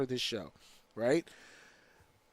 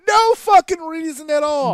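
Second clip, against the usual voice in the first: high, loud exasperated yell; exasperated shout; 12 semitones higher, 11 dB louder than usual. Real, not acted. Wrestling fan ranting about a needless backflip, shouting. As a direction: blow up at the pointless backflip.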